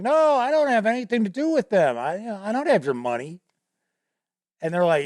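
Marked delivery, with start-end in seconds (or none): 0.0-3.4 protesting denial. Retold or imitated. Retold player denying he is thief.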